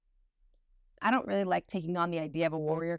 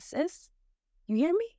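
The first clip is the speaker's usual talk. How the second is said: emphatic high call; 9 semitones higher than usual. Real, not acted. Urging listener to let go.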